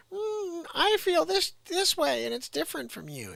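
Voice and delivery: a loud falsetto send-up of a polite disagreement; squeaky mock voice. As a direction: squeak it out like a cartoon. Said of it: "you could be like".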